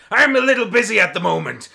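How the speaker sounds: booming high-pitched bellow of a giant pirate captain; big strained battle shout; busy Goliath shouting. Acted. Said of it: "mid-smash stops and goes".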